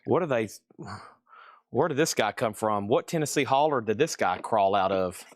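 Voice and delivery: low-pitched mock disbelief of a new viewer; imagined baffled viewer. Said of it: "Somebody going like".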